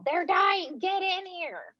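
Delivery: high urgent call down the hallway; yelling down the hall. Retold or imitated. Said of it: "You have to just yell out the hall".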